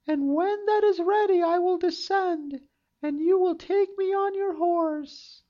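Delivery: put-on high girlish storybook voice; high young maiden voice; Rapunzel's voice. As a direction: think it as a hopeful young girl. Rapunzel speaks to prince.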